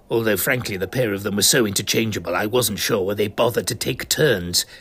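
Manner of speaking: plain reading voice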